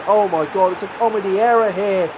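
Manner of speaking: ordinary talk, nothing marked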